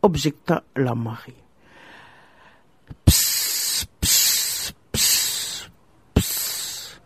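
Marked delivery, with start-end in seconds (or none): 3.0-7.0 soft breathy psst hisses from the snake. Acted. The serpent hissing softly at the young wife. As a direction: hiss each psst like a serpent. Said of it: "siffla le serpent".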